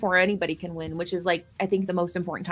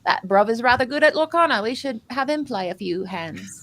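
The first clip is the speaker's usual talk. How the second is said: put-on playful British accent, rising on "bruv"; mock British accent; 3 semitones higher than usual. Casters introducing Harlan, in a playful British accent. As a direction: ham up a Londoner's accent. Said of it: "we're done with that accent".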